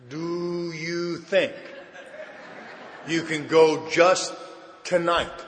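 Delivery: drawn-out, patronizing slowness; mocking. Sponsor talking down to a panicking drinker, slow mockery. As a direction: spell it out mockingly, word by stretched word. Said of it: "Now I know he's mocking me again".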